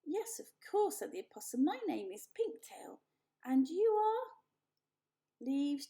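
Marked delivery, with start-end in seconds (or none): 0.0-4.3 theatrical little animal, proud; lively opossum voice. Acted. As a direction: play a vain animal actor. Opossum introduces herself.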